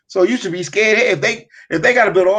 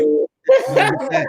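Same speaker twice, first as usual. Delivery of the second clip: mocking high voice; slower than usual. Mock warning about getting robbed.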